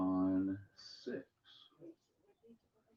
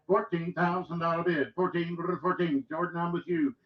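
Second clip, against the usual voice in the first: rapid rhythmic auction chant; auctioneer; 6 semitones higher, 4 dB louder than usual. Acted. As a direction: roll the numbers like a bid caller. Auction bid calling.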